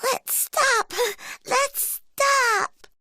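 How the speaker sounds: very high cub voice, giggly and pleading; little bear voice. Acted. Little Brown Bear caught in a heap with dad, very high cub voice. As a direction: squeak it out like a tiny bear stuck in a heap. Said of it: "Little Brown Bear says".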